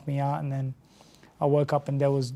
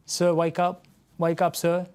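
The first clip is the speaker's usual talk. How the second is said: stranger waking him; 3 semitones higher than usual. Retold or imitated. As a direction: call him back to consciousness, urgent but kind. Stranger slapping him awake.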